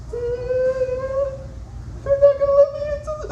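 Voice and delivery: very high, squealed fake sobbing; mock ugly crying. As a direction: wail it like a hammed-up blubbering teen. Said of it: "One of them's just ugly crying".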